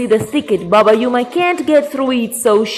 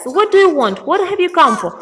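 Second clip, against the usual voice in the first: lightly voiced, higher-pitched grandpa; grandpa questioning; 7 semitones higher, faster than usual. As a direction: question the bird with a raised pitch. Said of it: "grandpa looks at it and says".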